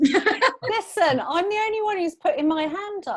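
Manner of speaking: high-pitched, playful protest; mock indignation. Real, not acted. Panellist protesting to the host about being passed over, mock indignant. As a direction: protest loudly at being overlooked, half joking.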